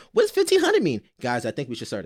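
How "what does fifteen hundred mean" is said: mocking quote. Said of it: "as soon as he got asked".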